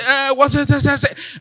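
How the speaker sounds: high-pitched stammering imitation, tripping over the words; mock French speakers fumbling English. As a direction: stumble through it like English is rubbish in the mouth. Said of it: "the thing is rubbish in their mouth".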